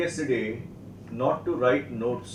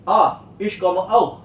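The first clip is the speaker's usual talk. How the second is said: higher, louder correction landing on auch; emphatic prompt highlighting auch; stress on auch; 6 semitones higher, 7 dB louder than usual. Shown as a demonstration. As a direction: remind the learner with a strong auch. Teacher prompts pupil to include auch.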